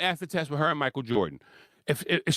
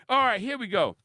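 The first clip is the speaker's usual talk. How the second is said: ordinary delivery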